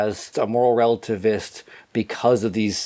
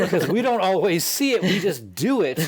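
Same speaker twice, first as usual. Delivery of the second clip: laughing; 6 semitones higher than usual. Real, not acted. Chuckling at the hands habit.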